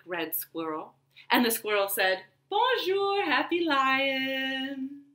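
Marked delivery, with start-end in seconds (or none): 2.5-5.1 high, bright sing-song bonjour; cheerful greeting. Acted. Zookeeper's son greets the lion in a high cheery voice.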